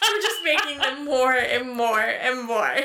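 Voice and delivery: ordinary talk, nothing marked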